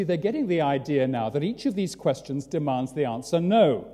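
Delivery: normal talking voice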